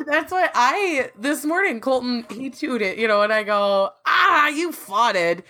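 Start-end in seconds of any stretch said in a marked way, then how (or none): 4.1-5.4 slow, teasing higher call-out; playful drawn-out tease; mock scolding. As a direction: drag it out, teasing the kid. Mother teasing son.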